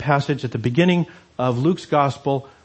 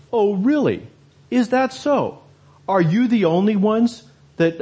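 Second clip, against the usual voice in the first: mocking disbelief, pitched higher; sarcastic Paul; 6 semitones higher than usual. Paul mocking the Corinthian objection, high and sarcastic. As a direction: scoff at the objection in a high, incredulous voice. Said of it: "Paul is being sarcastic".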